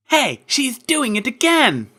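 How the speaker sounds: high, loud, slow outraged cry; protesting. Acted. Rainbow Dash protests at Trixie's show, high and loud. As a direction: call her out. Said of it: "Rainbow Dash protested".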